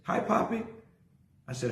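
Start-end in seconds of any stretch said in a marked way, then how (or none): 0.0-0.6 lightly voiced small girl, higher; a hint of a toddler's bright hello; little daughter. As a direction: chirp it like a three-year-old greeting her dad. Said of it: "And she says".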